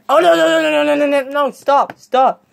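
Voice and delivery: high, rattled-off string of protests; panicked pleading. Acted.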